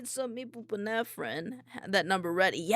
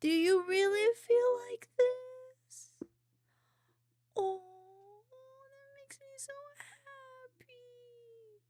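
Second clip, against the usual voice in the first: very high, happy squeaky whine; touched, near tears; 12 semitones higher than usual. Real, not acted. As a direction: squeak it slowly, overcome with happiness. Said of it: "you're gonna make me cry".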